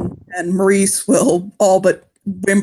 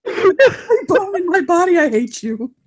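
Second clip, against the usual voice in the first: mock whiny; 8 semitones higher, slower than usual. Mocking Dev's text.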